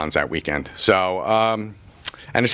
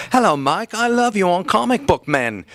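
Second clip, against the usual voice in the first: a hammed-up British fan's accent, gushing; imitating a British fan; 11 semitones higher than usual. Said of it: "he's got a British accent".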